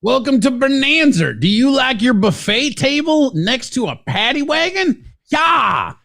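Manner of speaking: high, put-on hammy greeter; mock greeter voice. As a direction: sell it like a cheesy host. Mock restaurant greeter bit.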